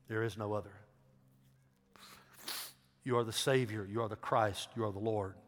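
low, measured prayer in short phrases; leading prayer. Shown as a demonstration. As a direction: pray line by line for others to repeat. Leads congregation in prayer to repeat.

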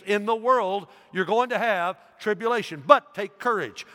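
loud, high preacher's cry; preaching at full force. Acted. Preacher rousing the church, loud and high. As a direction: proclaim it to the congregation.

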